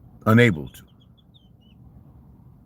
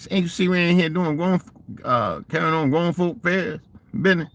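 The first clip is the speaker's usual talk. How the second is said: higher, mock-indignant old relative telling kids off; scolding elder; 9 semitones higher than usual. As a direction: lightly play an old aunt shooing nosy kids. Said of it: "elders scolding the kids".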